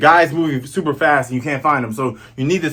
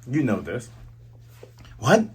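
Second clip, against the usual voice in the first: normal talking voice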